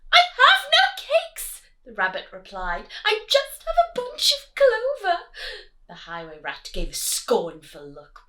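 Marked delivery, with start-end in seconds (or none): 0.1-1.6 very high, loud squeaky rabbit; frightened rabbit. Acted. Rabbit squeaking at the rat, frightened and high. 3.0-5.3 very high, loud crying rabbit; frightened rabbit. Acted. Rabbit pleading with the rat, high and crying.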